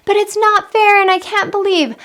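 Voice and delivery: put-on high, loud kid whining it's not fair; whiny child protest. Parent coach acting a child's protest, high and whiny. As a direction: play the sulky child cutting in.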